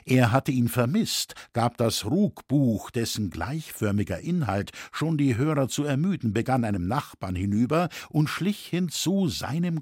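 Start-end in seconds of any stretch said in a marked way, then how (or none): none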